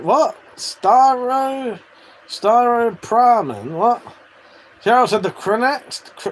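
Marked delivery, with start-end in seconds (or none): 0.6-3.7 Staro drawn out, pitched higher; insistent pronunciation. Shown as a demonstration. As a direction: stretch Staro Pramen out slowly. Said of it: "It's called Staro".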